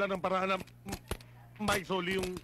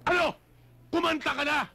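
sharp demand; 9 semitones higher, 5 dB louder than usual. Acted. Cop interrogating a suspect.

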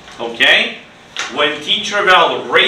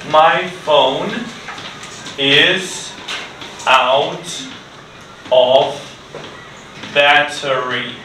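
slow dictation; 2 semitones lower than usual. Shown as a demonstration. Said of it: "write it down".

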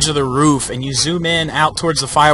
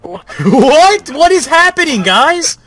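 high, loud, alarmed questions; startled shouting; 12 semitones higher, 6 dB louder, slower than usual. Real, not acted. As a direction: shout in shock and confusion. Startled friend reacting to screams.

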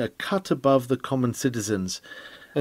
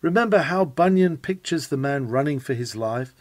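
normal talking voice

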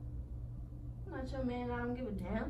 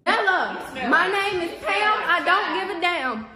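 ordinary talk, nothing marked

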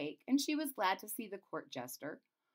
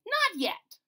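jester voice; 12 semitones higher, 7 dB louder than usual. Acted. Jester answers brightly.

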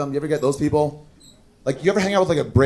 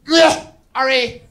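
high, slow, dragged-out sneer of a friend's name; drawn-out put-upon sneer, much higher; friend's disgusted reply, slow and high; disgusted impression of Margaret; 9 semitones higher, much slower than usual. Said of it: "That was the exact impression".

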